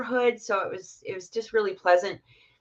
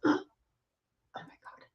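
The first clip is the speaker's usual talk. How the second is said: awed whisper; 8 dB quieter than usual. Retold or imitated. Family staring at glowing orb.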